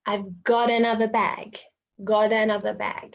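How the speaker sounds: "got another" as go-tanother; linked phrase. Shown as a demonstration. Teacher demonstrating linking. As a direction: roll the t into another. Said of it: "put got and another together".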